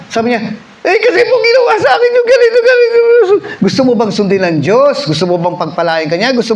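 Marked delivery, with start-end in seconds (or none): 0.8-3.0 very high, loud, fast crying yell; mock wailing shout. Preacher mimics the girl bawling at the altar, mock crying shout. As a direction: bawl it out in a hammed-up sob.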